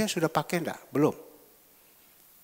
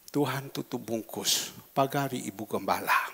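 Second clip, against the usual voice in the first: quiet prayer; 6 semitones lower, 5 dB quieter than usual. Real, not acted.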